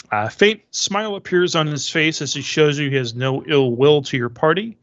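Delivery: ordinary delivery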